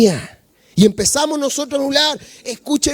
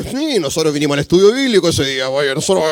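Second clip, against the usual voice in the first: put-on self-righteous older brother, fast; smug older brother; 10 semitones lower, much faster than usual. Older brother boasts to the congregation, smug and quick. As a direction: brag like the dutiful older son. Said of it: "nos portamos como el hijo mayor".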